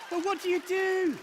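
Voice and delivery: high, loud cry of bewilderment; comic exasperation. Real, not acted. Exasperated climax of comic anecdote.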